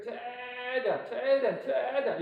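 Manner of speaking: long drawn-out vowels on 최대한; stretched-out urging. Shown as a demonstration. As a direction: stretch each 최대한 to match a huge movement. Coaching a maximally big movement.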